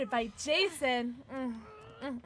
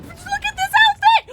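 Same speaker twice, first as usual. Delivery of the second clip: squealing high delight; excited gasp; 12 dB louder than usual. Real, not acted. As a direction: gasp and squeal over cuteness. Excited about cute outfit.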